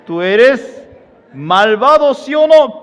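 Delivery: high, loud accusation; angry thief. Acted. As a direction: yell it while slapping him. Thief beats the husband.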